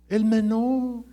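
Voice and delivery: "el menor" loud and high; emphatic shout. Real, not acted. Emphatic call-out. ("el menor" gritado, agudo y fuerte)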